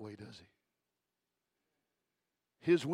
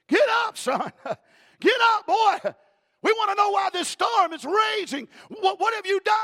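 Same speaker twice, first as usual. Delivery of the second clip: high, loud yell of a panicked sailor; shipmaster shouting; 11 dB louder than usual. Acted. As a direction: roar at the sleeping preacher to wake him. Sailors wake sleeping Jonah.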